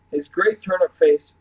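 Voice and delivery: ordinary delivery, nothing marked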